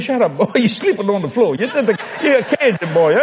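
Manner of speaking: high-pitched dismissive telling-off; hammed-up exasperated mama; mock scolding mother. His mother scolds him, retold.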